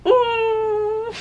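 long, high, loud whine; high swooning squeal. Real, not acted. Reader hears he only wants her, squealing. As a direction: squeal as your heart melts. Melting at the line.

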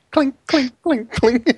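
high clinking sound effect; imitated clinking rocks. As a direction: mimic two rocks cracking. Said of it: "It's just like".

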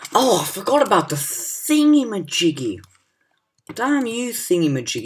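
loud, high exasperated cry; real frustrated yelling; annoyed outburst. Real, not acted. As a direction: curse the gadget at full volume. Annoyed at the game.

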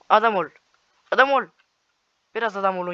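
high, loud mock-scold; shouting at an opponent to behave. Real, not acted. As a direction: bark it at the enemy. Scolding enemy in game.

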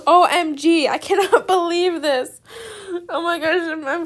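loud, high, half-laughing disbelief; squealing with real joy; loud thrilled gasping; excited squeal. Real, not acted. Thrilled at getting a shadow dragon.

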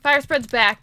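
ordinary delivery

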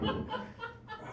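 laughing. Real, not acted. Laughing mid-story.

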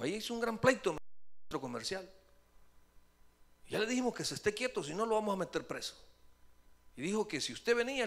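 mock policeman. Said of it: "me dijo".

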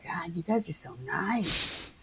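soft, slow mock gushing; touched guest star. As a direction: softly play the flattered newcomer. Acted gushing guest stars.

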